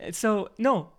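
ordinary talk, nothing marked

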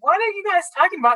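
high, quick baffled question; retold bafflement. Puzzled woman to the coworkers crowding her desk, baffled.

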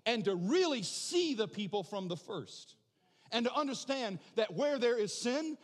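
loud, rising, full-force preaching; worked-up preaching. Real, not acted. Preacher getting carried away before the congregation. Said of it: "I'm getting too worked up here".

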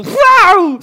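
high, loud cat yowl; imitated cat screech. Cat swiping at its owner again, high loud yowl. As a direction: screech suddenly like an attacking cat. Chat qui attaque le streamer.

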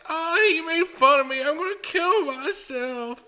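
mocking whiny kid. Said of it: "kids ... being like".

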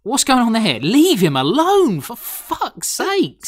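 high, drawn-out exasperated plea; exasperated protest. Real, not acted. Podcast host defending poor Teddy, exasperated high protest. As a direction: stretch each word in rising indignation.

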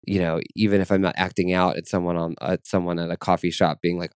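usual speaking voice

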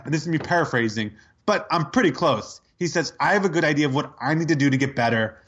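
ordinary talk, nothing marked

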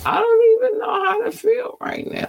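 high squeal. Acted. Flustered reaction after laugh.

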